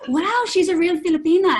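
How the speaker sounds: loud, high impressed 'wow'; big admiring exclamation, acted out; mock amazed exclamation. Others' admiring reaction acted out.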